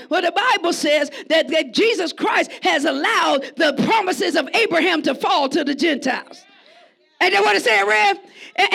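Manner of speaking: full-force preacher appeal; fervent preaching. Real, not acted. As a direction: urge the brothers on. Sermon exhortation.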